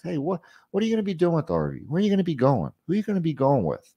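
quick, easy, matter-of-fact questions. Shown as a demonstration. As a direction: ask it casually, without pressure. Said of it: "kind of matter-of-factly".

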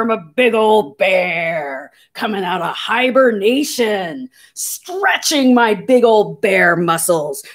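slow, growly pretend bear just out of hibernation; playful growling bear voice; big old bear. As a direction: roar and stretch your words like a sleepy bear. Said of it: "I want you to roar".

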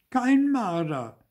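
high, drawn-out cry; shouted protest. A narrator's reading. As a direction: cry it out high, slow and loud. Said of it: "schrie Zäpfel". (hoch und gedehnt schreiend)

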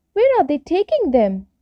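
high, small questioning voice; curious little fir tree. Acted. Little fir tree asking the stork, high and curious. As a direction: ask it high, eager to know.

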